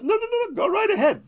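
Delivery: high, loud, emphatic no-no-no; insistent angel. Acted. Angel to Bilam, urging him onward, high and insistent. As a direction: sing it out high, waving him on.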